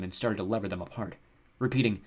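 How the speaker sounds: ordinary delivery, nothing marked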